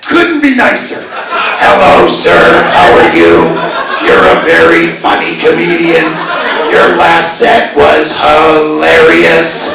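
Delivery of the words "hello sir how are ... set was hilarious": low, loud droning monotone; put-on low voice, stretching out the compliment; heckler turned nice. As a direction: imitate him being polite in a low drone. I see the guy now, couldn't be nicer.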